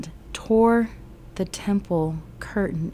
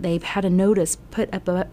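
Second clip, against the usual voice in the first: ordinary talk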